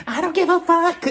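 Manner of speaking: high, loud mock scream of the line; high mock shriek. Shown as a demonstration. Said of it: "She said it like this".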